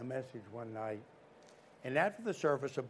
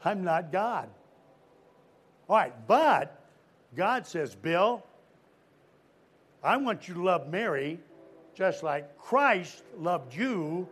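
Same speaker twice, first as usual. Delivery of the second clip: louder, higher preaching voice, hammering each phrase; full-force preaching; 5 semitones higher, 7 dB louder, slower than usual. Acted. Preacher to young crowd, preaching at full force. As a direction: preach it out to the camp kids. Preacher pounding the point home.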